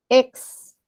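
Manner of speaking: "ex" drawn out alone, closed on the x; wrongly split syllable. Shown as a demonstration. Teacher warns against separating the prefix, wrongly split. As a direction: isolate "ex" as its own syllable. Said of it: "No vayamos a pretender separar".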